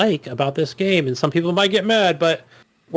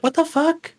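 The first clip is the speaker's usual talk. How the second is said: baffled outburst; 11 semitones higher than usual. Retold or imitated. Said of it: "I'm like".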